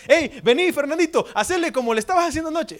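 put-on high, eager call to the child, quick at the end; proud mom calling. Proud mom urging son to perform at family gathering, eager call. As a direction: summon the kid to show off. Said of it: "como una mamá orgullosa".

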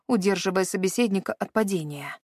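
usual speaking voice

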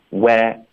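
"where" cut to an open "weh", jaw dropped; open-mouthed vowel. Shown as a demonstration. Teacher showing the first half of wait, wide open "where". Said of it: "嘴巴要张开到你的上下齿之间一个手指的宽度".

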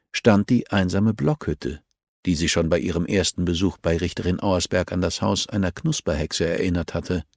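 ordinary talk

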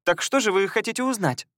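chirpy high sweet lady; cartoon princess. A narrator's reading. Elizia asks the guests.

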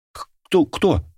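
high, stammering startled gasp; startled and stuttering, pitched far up; frightened cry. A narrator's reading. Girl recoiling from a stranger, frightened cry. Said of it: "вскрикнула девушка".

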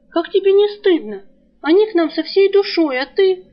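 high, loud scolding; reproachful scolding. Acted. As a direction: shame him, voice up and ringing. Girl scolds friend for stealing.

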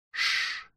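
soft, voiceless "shh"; hushing shush. A narrator's reading. Philippe suddenly stops and hushes his companions, softly. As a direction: hush them quietly.

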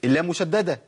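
usual speaking voice

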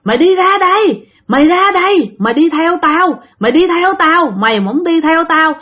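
high, insistent mock tirade; retold noisy scolding. Ghost at the front door ordering the dreamer out, scolding. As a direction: act out a ghost's threats, pitched high. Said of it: "chửi la um sùm".

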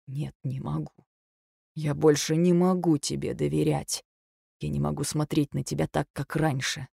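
soft and broken; husband refusing to trust her; quiet heartbroken refusal. A narrator's reading.

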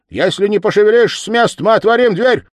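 high, loud threat; clerk's warning. Acted. Clerk warns whoever is behind the door, high and loud. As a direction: call through the door, high and forceful. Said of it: "продолжал писарь, приложась губами к скважине".

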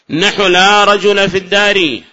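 slow formal reading; example read out. Shown as a demonstration. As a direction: declaim the example sentence. Reads example from text.

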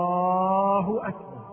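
"الله أكبر" with the alif before the haa stretched far too long; overlong vowel in Allah. Shown as a demonstration. Teacher demonstrating a disliked takbir, "الله" overstretched in the middle. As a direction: drag out the aa inside "الله", then finish "أكبر". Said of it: "فإن بالغ حتى أحدث ألفا ثانية بين اللام والهاء".

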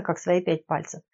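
plain reading voice

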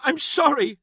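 high, upset outburst; sobbed apology. A narrator's reading. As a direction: apologise through narrated tears, pitched well up. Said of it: "she sobbed".

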